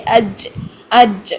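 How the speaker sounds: "اج" ending in a breathy ch sound; faulty jeem demo. Shown as a demonstration. Tajweed teacher showing how ch slips out, faulty jeem. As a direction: release the jeem of "اج" as ch. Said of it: "چے والی sound نکل-، نکلتی ہے".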